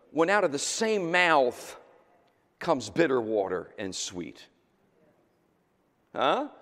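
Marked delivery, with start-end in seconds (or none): none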